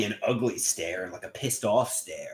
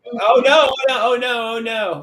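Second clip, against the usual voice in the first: sudden high alarmed oh no, louder; panicked cry as the sound plays; real alarm; 12 semitones higher, 8 dB louder than usual. Real, not acted. Alarmed at stray audio on stream.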